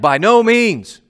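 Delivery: high, loud By no means; Paul rejecting the objection outright; emphatic denial. Retold or imitated. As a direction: fling out the denial with full force. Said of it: "the most emphatic way of saying".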